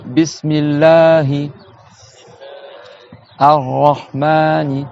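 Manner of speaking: Bismillahi Ar-Rahmani, very slow, long vowels, pause between; slow choral model. Shown as a demonstration. Teacher leading students, Bismillahi Ar-Rahmani slow and drawn out. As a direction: draw out Bismillahi Ar-Rahmani for a class. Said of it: "on dit ensemble".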